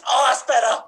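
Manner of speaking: high, loud relieved porcupine groan; lightly voiced porcupine sighing with relief; porcupine character. Porcupine partner relieved by a back scratch, high and loud. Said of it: "as if you were porcupines".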